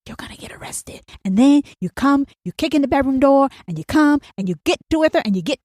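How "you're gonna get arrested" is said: breathy unvoiced whisper; woman whispering a threat back to a caller. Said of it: "she whispers back to you".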